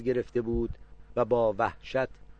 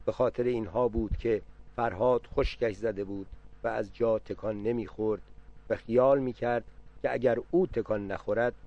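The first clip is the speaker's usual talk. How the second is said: usual speaking voice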